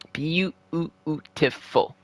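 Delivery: Beautiful stretched very slow and soft; slow savouring murmur of Beautiful; drawn-out beautiful. Real, not acted. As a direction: draw out Beautiful like you're savouring it. Savouring the power play goal.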